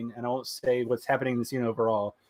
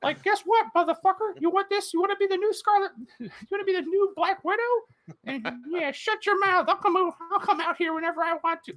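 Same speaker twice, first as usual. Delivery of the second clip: mock Mickey Mouse. Mickey Mouse costume cursing at the actress, very high squeak. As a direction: squeak it like a foul-mouthed Mickey. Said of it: "And it's like".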